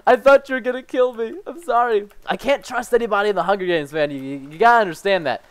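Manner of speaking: normal talking voice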